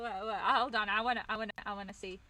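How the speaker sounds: ordinary delivery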